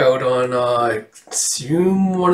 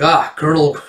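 a bright, louder robot hello; cheery robot greeting; 3 semitones higher, 5 dB louder than usual. Acted. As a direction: greet the old Colonel like an eager machine.

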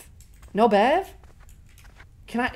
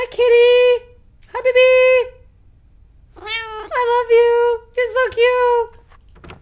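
cooing to a pet; 13 semitones higher, 7 dB louder, slower than usual. Real, not acted. Player greeting the game's cat, high squealing baby talk. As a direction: squeal sweet nothings to a kitty.

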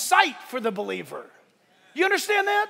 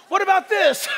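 pushy doctor; 8 semitones higher, 5 dB louder than usual. Retold or imitated. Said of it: "They go like".

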